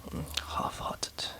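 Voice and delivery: usual speaking voice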